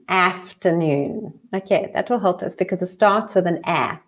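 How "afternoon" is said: afternoon said slowly in an American accent, with a clear r in the middle; slow American afternoon with a rising end. Shown as a demonstration. Teacher shows the class the American way, American accent. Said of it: "say it like an American".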